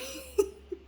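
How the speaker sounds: short, high-pitched laugh through pain. Real, not acted. Vlogger laughing at her own troubles, high pained laugh. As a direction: laugh because it hurts. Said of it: "I'm laughing because it hurts".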